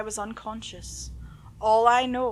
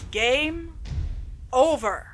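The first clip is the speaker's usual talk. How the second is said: higher and louder, spaced out game over; a cold game-over announcer; ominous game-over voice; 6 semitones higher, 7 dB louder, slower than usual. Acted. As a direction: announce it like a sinister video game. Sinister text message read aloud.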